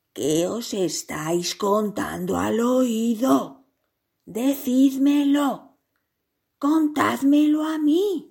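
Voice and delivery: slow, rising to high and loud; insistent demand. A narrator's reading. Giraffe left out of whispering, demanding to know. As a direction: press harder with each plea to be told.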